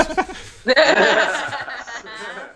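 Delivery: big burst of high laughter; loud high laugh. Real, not acted. Laughing about breakups.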